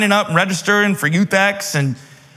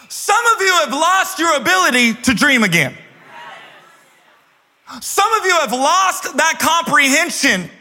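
full force preaching; 7 semitones higher than usual. Acted. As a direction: preach it to the back row.

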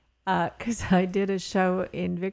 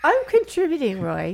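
playful protest; 6 semitones higher, 5 dB louder than usual. Real, not acted. Cohost defends herself laughing.

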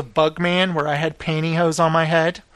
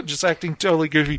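ordinary talk

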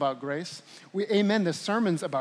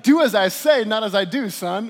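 mock stern parent; 8 dB louder than usual.

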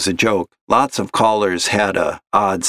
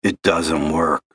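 put-on gravelly old caller; 4 semitones lower than usual. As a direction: drag it out like a heavy smoker. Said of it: "gravelly voice like that".

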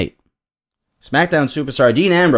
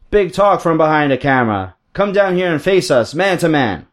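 higher, emphatic, badly faked Iron Sheik accent; terrible Iron Sheik accent; 4 semitones higher than usual. Iron Sheik threatens Ryback on the mic, badly faked Sheik accent. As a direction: taunt in a bad Sheik accent, ending on a mock spit. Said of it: "That's a terrible Sheik accent".

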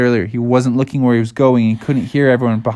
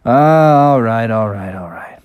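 grudging Bubby. Acted. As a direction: give in, trailing off to near whisper. Scolded Bubby gives in.

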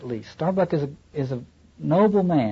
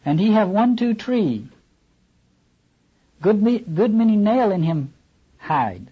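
higher, halting broken English; disjointed pidgin English; 7 semitones higher than usual. Acted. Queequeg crying to the captain in broken English. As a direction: cry it out in Queequeg's choppy pidgin. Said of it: "cried Queequeg disjointedly".

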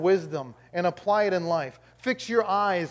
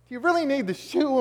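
high-pitched whiny question; mock exasperation; 9 semitones higher, 3 dB louder than usual. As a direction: replay the complaint in a raised, put-upon voice. Replays husband's upset question.

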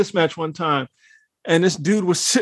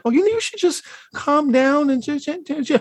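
mocking dismissive voice; 9 semitones higher than usual. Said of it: "it's like".

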